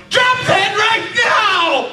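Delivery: Tamaki yell. Shown as a demonstration. Actor demonstrating Tamaki's yell to the panel, kept down. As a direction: yell it, but keep it from flipping. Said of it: "Tamaki might go".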